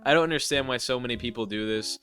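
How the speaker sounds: ordinary talk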